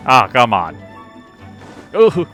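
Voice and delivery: ordinary talk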